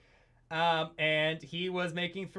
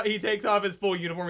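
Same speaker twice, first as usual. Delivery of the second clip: ordinary talk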